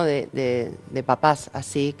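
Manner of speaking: hesitant, struggling to say it; quiet grief. Real, not acted. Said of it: "Me, me cuesta hasta nombrar lo que les pasó".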